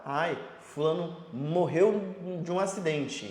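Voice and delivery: slow, lamenting report of a death; a slow, hammed-up lament; mock sad news. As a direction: drag it out like sad gossip.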